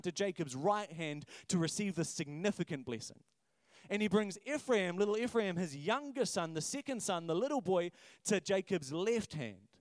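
ordinary talk

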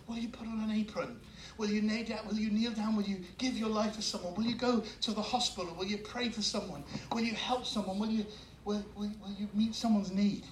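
hushed, earnest appeal; soft altar call. Acted. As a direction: invite them gently, one by one.

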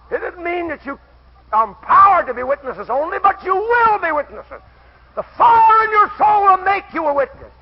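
loud, high declaiming of scripture; preaching at full force. Real, not acted. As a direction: thunder the verse at the congregation.

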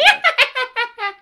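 evil cackle. Acted. Evil laugh in the story.

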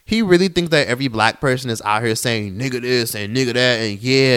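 ordinary talk

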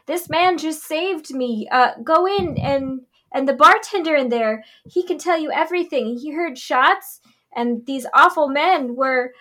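high, breathless distressed lady; damsel in distress. Acted. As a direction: play the frantic rescued victim. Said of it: "Hera ... runs out towards them, like".

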